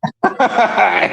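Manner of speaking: high burst of laughter; laughing. Real, not acted. Host laughs at co-host's jaw remark, high laughter.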